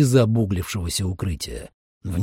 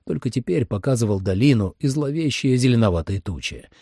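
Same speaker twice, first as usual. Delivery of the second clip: ordinary delivery, nothing marked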